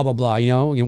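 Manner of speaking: ordinary talk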